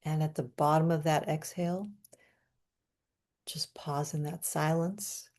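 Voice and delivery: soft, hushed meditation guide; guided breathwork. Acted. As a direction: ease them into the pause quietly. Leads breathwork pause.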